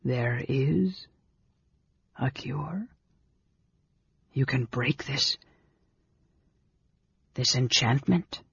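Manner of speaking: hushed, hoarse, halting question; soft, halting, near-whispered disbelief. A narrator's reading. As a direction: whisper it hoarsely, with long pauses. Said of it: "he whispered hoarsely".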